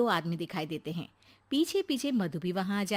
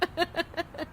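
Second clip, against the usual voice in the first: light laugh; 9 semitones higher, 4 dB quieter than usual. Acted. Laugh after teasing.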